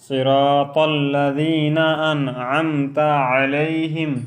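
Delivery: slow, melodic, drawn-out tilawat; Quranic recitation. Shown as a demonstration. Teacher reciting Fatiha as in prayer, slow melodic chant. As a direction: chant it slowly with long vowels.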